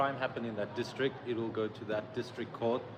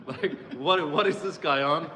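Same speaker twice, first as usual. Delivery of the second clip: laughing; 3 semitones higher, 5 dB louder than usual. Retold or imitated. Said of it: "the judge kind of went like this".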